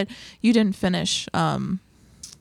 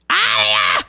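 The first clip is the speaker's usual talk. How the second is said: mock yell; 10 semitones lower, 9 dB louder than usual. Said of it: "she's just like".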